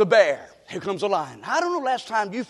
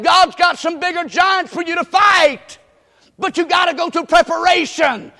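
raised, emphatic sermon cadence with drawn-out words, building; full-force preaching; 7 semitones higher, 9 dB louder than usual. Real, not acted. Preacher encouraging people through their trials, preaching at full force. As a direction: drive the promise home, louder and higher.

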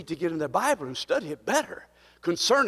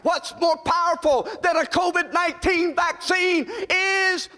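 preaching at full force; 11 semitones higher than usual. Acted. Pastor rousing his congregation about the cleansing blood, full-force preaching. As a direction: drive every line home to the pews.

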